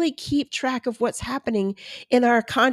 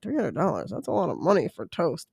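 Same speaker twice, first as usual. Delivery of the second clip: softer, lower aside to self; muttered price objection; 3 semitones lower, 6 dB quieter than usual. Retold or imitated. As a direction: balk at the price under your breath. Balking to herself at toaster price.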